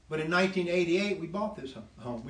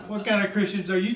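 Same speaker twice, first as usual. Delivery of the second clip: laughing; 6 semitones higher, 8 dB louder, faster than usual. Real, not acted. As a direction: repeat it through a laugh, higher and louder. Repeats question laughing.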